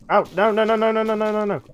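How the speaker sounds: high, frantic string of no's; panicked pleading. Real, not acted. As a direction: beg as you get hit. Taking damage in game.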